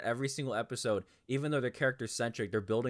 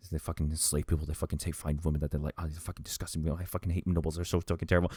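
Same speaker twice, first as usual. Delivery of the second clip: muttering, low and rushed; imitated bitter low muttering; Yuna; 6 semitones lower than usual. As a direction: grumble under the breath in a low, disgusted run. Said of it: "muttering about the fucking nobles".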